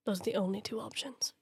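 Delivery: whispered. Acted. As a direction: say it under your breath, grimly. Clone whispers his point.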